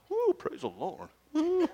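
high whooping woo, praise the Lord; hip praise whoop. Shown as a demonstration. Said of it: "do the hip thing".

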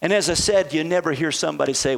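normal talking voice

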